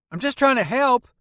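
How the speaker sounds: put-on high, lively teenage boy voice; young Peter talking about his grandma. A narrator's reading. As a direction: play the chatty kid in the back seat.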